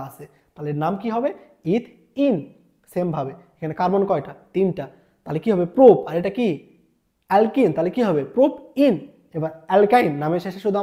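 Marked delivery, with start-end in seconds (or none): 1.8-2.4 ইথ ইন raised, split into two parts; ending sounded out. Shown as a demonstration. Teacher naming the two-carbon alkene, ending sounded out. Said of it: "আমরা অ্যালকিনের নামকরণ করব". 8.4-8.9 প্রোপ ইন high and loud, in two parts; ending sounded out. Shown as a demonstration. Teacher naming the three-carbon alkene, ending sounded out. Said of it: "আমরা অ্যালকিনের নামকরণ করব".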